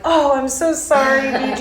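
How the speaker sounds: high, effusive mock apology; lightly acted embarrassed apology; retold gushing apology. Retelling her apology for forgetting.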